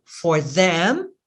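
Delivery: ordinary talk, nothing marked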